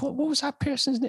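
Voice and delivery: high, careless mock Rogan; lightly mocking Rogan's shrug; imitating Joe Rogan. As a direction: toss off a forgotten name casually. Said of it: "he swears and he goes".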